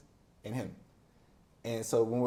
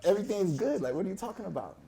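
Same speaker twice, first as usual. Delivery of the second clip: much higher, rushed and flustered; flustered kid self; 8 semitones higher, 5 dB louder than usual. Retold or imitated. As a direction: defend your mom, flustered and unsure. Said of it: "I'm like".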